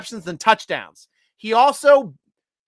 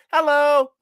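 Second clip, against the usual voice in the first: ordinary delivery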